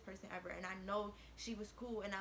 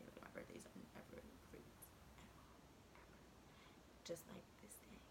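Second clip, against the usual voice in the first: soft whisper, half to herself; whispered aside; 3 semitones lower, 15 dB quieter than usual. Real, not acted. Confiding to the listeners about her birthdays, whispered. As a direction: breathe it out as a private aside.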